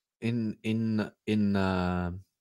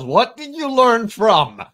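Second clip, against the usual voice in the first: drawn out, high, loud mocking echo of the question; mock parroting; 11 semitones higher, 8 dB louder than usual. Echoing his cohost's question back, slow, high, mocking. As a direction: parrot it back slowly with a teasing lilt.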